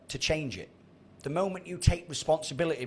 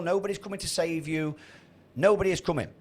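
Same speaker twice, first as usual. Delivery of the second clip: usual speaking voice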